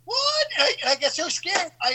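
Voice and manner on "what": high startled yelp of what. Retold or imitated. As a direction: jump out of your skin asking what. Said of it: "And I'm like".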